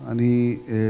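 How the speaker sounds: normal talking voice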